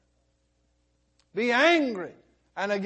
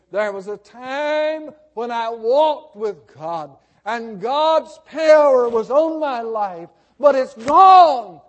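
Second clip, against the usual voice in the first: anguished outcry; 3 semitones higher, 9 dB louder than usual. Acted. Preacher voices a backslider confessing, crying out in anguish. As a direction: swell into an anguished cry on the last word.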